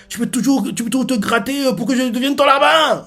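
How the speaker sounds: high-pitched mock-angry retort; cartoonish outraged comeback; indignant refusal. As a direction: refuse scornfully, voice climbing high. Baggy's angry refusal acted out.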